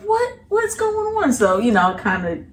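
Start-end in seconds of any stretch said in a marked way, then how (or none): 0.0-1.3 put-on confused kids. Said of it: "they were like".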